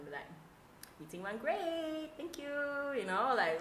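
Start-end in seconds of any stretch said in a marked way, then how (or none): 1.0-2.5 high, drawn-out false sweetness; fake smiling report. Host tells colleagues about meeting, falsely cheerful. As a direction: stretch it out through a forced smile. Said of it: "I'll smile at them".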